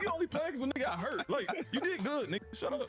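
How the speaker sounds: high-pitched retort broken by laughter; mock squabbling. Player retelling his comeback to a teammate in the locker room, mock exasperated.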